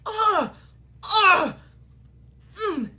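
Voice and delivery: imitating high, loud grunts of a child holding it in; mock strained grunting, fighting a meltdown. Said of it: "physically, like, trying to keep it together".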